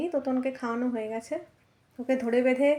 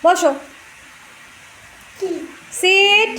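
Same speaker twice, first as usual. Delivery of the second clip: loud, high firm command; dog command; 8 semitones higher, 11 dB louder, much slower than usual. Real, not acted. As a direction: bark the word at the dog to make it sit. (জোরে কুকুরকে আদেশ)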